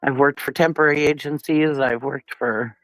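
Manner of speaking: ordinary talk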